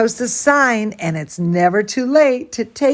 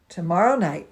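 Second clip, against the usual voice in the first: usual speaking voice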